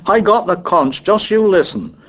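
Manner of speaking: put-on high-pitched indignant boy's tirade; lost temper. A narrator's reading. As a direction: rant at the others, pitched well up. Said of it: "Piggy lost his temper".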